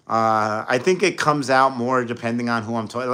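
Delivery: ordinary delivery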